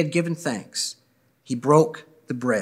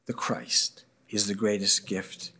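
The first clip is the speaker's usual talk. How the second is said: whispered reverently, softer; hushed whisper; 4 semitones lower, 7 dB quieter than usual. Real, not acted. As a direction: breathe it like a secret of faith. Closing blessing, hushed emphasis.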